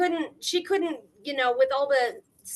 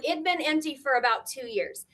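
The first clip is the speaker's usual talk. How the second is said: ordinary delivery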